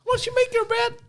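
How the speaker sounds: very high, squeaky self-help guru; Jordan Peterson. Retold or imitated. Lampooning the psychologist's life rules, squeaky impression. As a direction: lecture in a tiny, silly high voice. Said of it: "Can you do Jordan Peterson?".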